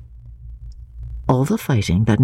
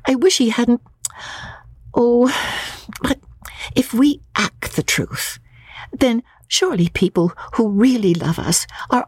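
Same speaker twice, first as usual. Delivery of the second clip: put-on higher young woman, earnest and wistful; Lucy's voice; 6 semitones higher than usual. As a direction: play Lucy wishing things were mended at home. Lucy voiced by narrator.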